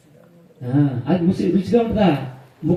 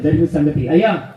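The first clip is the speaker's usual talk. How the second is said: ordinary delivery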